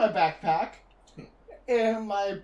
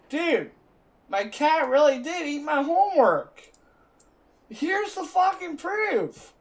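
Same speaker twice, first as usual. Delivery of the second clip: raised, higher indignant protest; indignant retort; 8 semitones higher, 6 dB louder than usual. Retold or imitated. To a yelling teacher, an indignant high protest. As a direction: insist the cat really ate it, heated.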